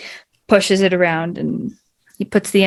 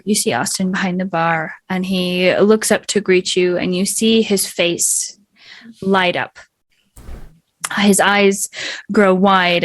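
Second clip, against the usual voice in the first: usual speaking voice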